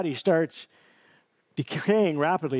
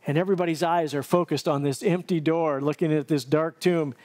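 ordinary delivery

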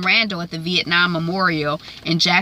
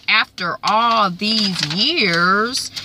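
slow, drawn-out disbelieving question rising at the end; incredulous repeat; 2 semitones higher, much slower than usual. Retold or imitated. Randall, stunned by the note, repeating it back slowly. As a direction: stretch out "After all these years?" in stunned disbelief. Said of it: "And Randall is like".

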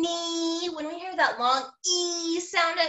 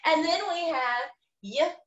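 normal talking voice

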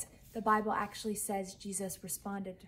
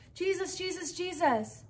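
retold frantic calls, high and loud; mock panicked messengers calling, louder at the end; frantic crying out; 5 semitones higher, 8 dB louder than usual. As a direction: cry out for Jesus in a panic, a touch held back. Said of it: "they're frantically screaming".

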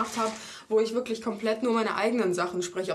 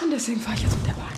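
usual speaking voice

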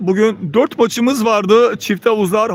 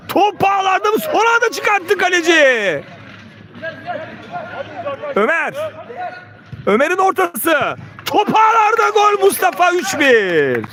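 rising goal scream, much higher; screaming football commentator; 8 semitones higher than usual. Real, not acted. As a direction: yell the goal call. Goal call in live commentary.

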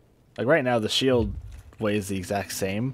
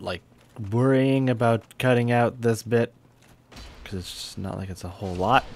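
ordinary talk, nothing marked

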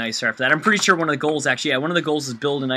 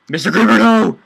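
real panicked yell, louder and higher; panicked shout; 8 semitones higher, 11 dB louder than usual. Real, not acted. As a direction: shout at the creeper to stop, desperate. Creeper about to blow house.

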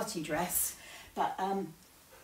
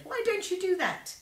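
coaxing grandpa; 12 semitones higher than usual. Acted.

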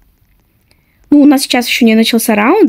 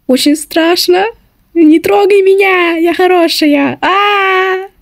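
playful fear; 6 semitones higher, slower than usual. Pretend. Seeker approaching in game.

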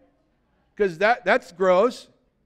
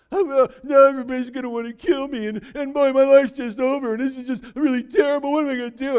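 high, whiny pouting complaint; pouting Cain; 5 semitones higher, 4 dB louder, faster than usual. Retold or imitated. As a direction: whine like a sulking child. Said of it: "He starts pouting".

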